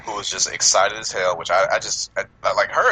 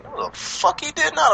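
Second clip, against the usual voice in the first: slow, high-pitched mock outrage; indignant retort; slower than usual. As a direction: retell it as drawn-out disbelief. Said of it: "looked at him and was like".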